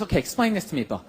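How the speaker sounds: lightly voiced nagging girlfriend; mock girlfriend. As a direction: challenge him like a lecturing girlfriend. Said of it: "she goes".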